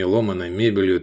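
ordinary delivery

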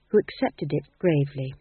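ordinary delivery

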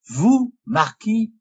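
slow, high, rising question; surprised. A narrator's reading. Lorenzo questions the marquis, with astonished surprise. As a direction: stretch it out in disbelief. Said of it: "avec l'accent de la surprise".

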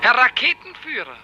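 high, loud summons; calling out. Acted. Director summoning the rocket pilot, loud call. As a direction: call across to him.

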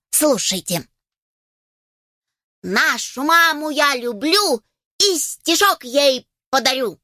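high slow recital voice; chick reciting poem. Acted. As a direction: recite proudly like a little chick.

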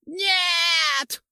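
very high, loud ghoul scream; terrified shriek. A narrator's reading. Ghoul screams as the sword falls, terrified. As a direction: scream in horror at the falling sword. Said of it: "завизжал поганец".

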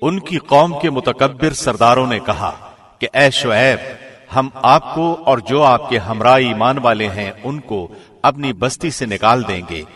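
ordinary talk

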